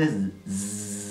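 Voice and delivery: "zzz" as a long, low voiced buzz. Shown as a demonstration. Teacher says listen well to the sound, buzzing "zzz". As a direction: draw out "zzz" like a mosquito's drone. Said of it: "Escucha bien el sonido".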